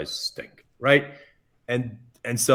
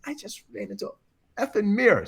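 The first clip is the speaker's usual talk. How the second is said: high-pitched, squeaky admission; retold embarrassed confession; 11 semitones higher than usual. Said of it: "I says".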